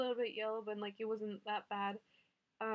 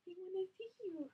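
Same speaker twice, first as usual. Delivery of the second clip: high, soft cooing voice; 9 semitones higher, 8 dB quieter than usual. Real, not acted. Mom cooing to her newborn son, high and soft.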